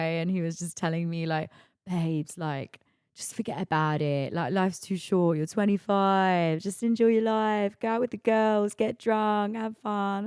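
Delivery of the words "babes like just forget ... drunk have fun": Essex cabbie. Retold or imitated. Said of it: "this Essex guy".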